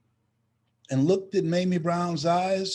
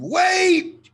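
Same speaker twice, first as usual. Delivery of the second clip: high, loud desperate scream; sudden frantic yell; 9 dB louder than usual. Retold or imitated. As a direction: scream it after the leaving car. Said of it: "she screamed".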